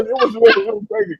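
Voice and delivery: ordinary talk, nothing marked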